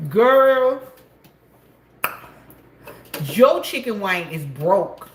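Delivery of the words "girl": calling out. Real, not acted. Calling out to friend.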